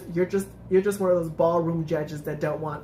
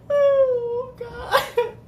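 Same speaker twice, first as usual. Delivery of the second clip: high squealing laugh-cry; 4 dB louder than usual. Real, not acted. Laughing at the result.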